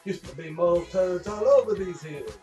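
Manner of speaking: usual speaking voice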